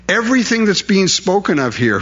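ordinary talk

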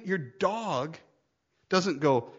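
usual speaking voice

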